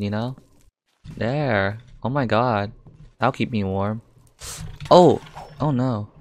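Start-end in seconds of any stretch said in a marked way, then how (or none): 4.9-5.2 short, high, loud "oh" of surprise; sudden real jolt; startled yelp. Real, not acted. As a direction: yelp as if caught out. Sudden trouble in game.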